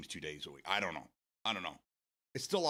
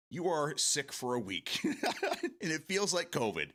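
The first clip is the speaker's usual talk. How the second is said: laughing; 6 semitones higher, slower than usual. Real, not acted. Laughing about forties hangovers.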